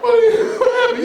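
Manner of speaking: high, loud, laughing disbelief; mock surprise. Imagined people greeting a teacher, in loud laughing disbelief. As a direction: act stunned someone showed up. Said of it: "you'd be like".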